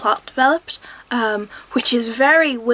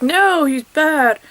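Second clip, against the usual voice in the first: mock stern Will; 4 semitones higher, 3 dB louder, much slower than usual. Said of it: "And he's like".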